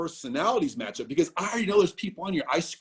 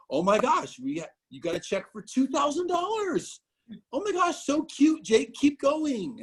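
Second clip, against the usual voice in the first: high, gushing mother voice; imitating an excited mother, pitched way up; mom voice; 10 semitones higher than usual. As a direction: squeal like a proud mom.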